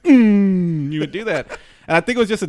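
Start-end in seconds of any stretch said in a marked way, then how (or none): 0.0-0.9 two loud, abrupt closed-mouth hums; imitated tic grunts. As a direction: punch out a double tic hum. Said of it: "I think that was, like, his tick".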